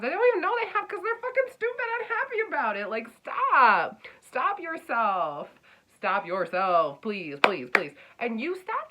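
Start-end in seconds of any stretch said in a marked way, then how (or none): 0.0-2.9 high, fast, loud venting; exasperated rant. Acted. Reader giving earth signs a talking to, ranting fast and high. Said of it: "you are getting a talking to". 2.9-7.9 loud, slow, emphatic plea; firm pleading stop. Acted. Reader telling earth signs to stop, loud slow plea. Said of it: "you are getting a talking to".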